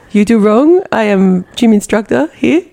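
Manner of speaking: put-on foreigner's broken-English voice; Anatoly impression. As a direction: play a foreign gym instructor correcting someone. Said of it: "Do the Anatoly thing".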